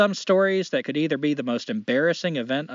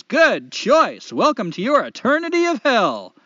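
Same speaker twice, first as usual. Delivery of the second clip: higher, louder, slow showman devil; 9 semitones higher, 6 dB louder, slower than usual. Acted. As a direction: greet him like a grinning host of hell. Devil voiced.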